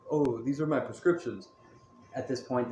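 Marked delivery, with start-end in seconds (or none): none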